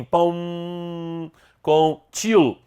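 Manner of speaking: "pão com til" drawn out slowly, nasal "pão"; slow demo. Shown as a demonstration. Teacher modelling the nasal vowel for the class, slow. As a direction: stretch each word out slowly. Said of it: "como se apenas tivesse escrito assim".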